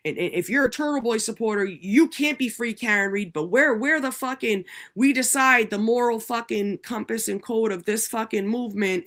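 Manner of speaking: high, sneering mock voice; mocking the rival camp. Mocking online critics, high sneering impression. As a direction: parody the self-righteous movement, pitched high. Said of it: "you know".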